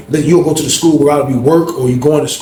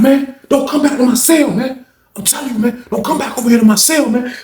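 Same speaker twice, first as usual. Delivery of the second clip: high, heated order to stay away; angry warning; 11 semitones higher than usual. Retold or imitated. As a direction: warn him off, worked up and high-pitched. Acts old head warning man off.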